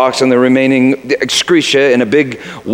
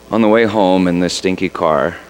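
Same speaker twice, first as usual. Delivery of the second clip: ordinary talk, nothing marked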